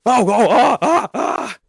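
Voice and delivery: high, loud, panicked yelps; mock panic. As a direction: shriek in pretend horror.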